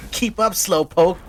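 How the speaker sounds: high, slow taunting hero voice; cocky Sonic. Acted. Sonic taunting Chris as he speeds ahead, cocky and high. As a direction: tease him like a cocky hedgehog. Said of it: "Sonic said".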